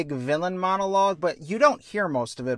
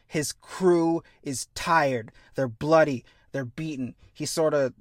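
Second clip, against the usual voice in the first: ordinary delivery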